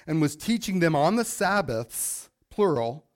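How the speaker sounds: ordinary talk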